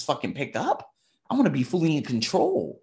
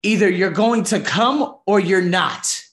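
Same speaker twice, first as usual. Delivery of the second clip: slow, louder firm ultimatum; 6 semitones higher, 8 dB louder than usual. Retold or imitated. Bar guy telling Lisa to commit, slow and loud. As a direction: lay it down flatly. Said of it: "And he said".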